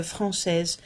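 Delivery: normal talking voice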